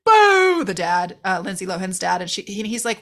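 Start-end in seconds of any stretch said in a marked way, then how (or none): 0.1-0.6 mock happy call. Tad calls to the dad.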